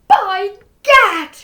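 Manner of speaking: loud, high exclamation; outraged cry. A narrator's reading. Duke cries out loud in alarm. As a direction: cry out in shock. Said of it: "cried the Duke".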